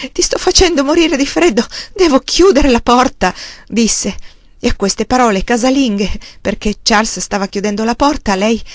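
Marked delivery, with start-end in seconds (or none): 0.1-3.3 flustered exclamation. A narrator's reading. Woman flustered, husband freezing at door.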